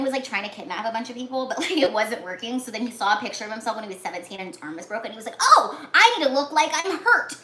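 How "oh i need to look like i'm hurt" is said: louder, higher mock eureka moment; acted sudden realization. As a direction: voice his idea like a sudden brainwave. Acts out his sudden idea.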